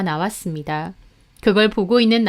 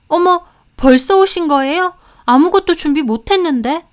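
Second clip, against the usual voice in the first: higher, louder flustered voice; flustered maid; 7 semitones higher, 6 dB louder than usual. A narrator's reading. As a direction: put on a fluster for the guests. Maid flustered at in-laws' arrival.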